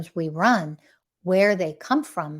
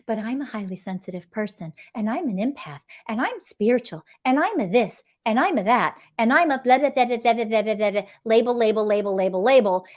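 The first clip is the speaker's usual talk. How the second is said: mocking excuses. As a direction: parody a self-justifying list, rattling into blah-blah. Mocks listeners' list of excuses.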